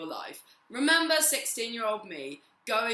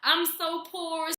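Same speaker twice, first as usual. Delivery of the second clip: mock woe; 8 semitones higher, 4 dB louder, slower than usual. Mock self-pity sigh.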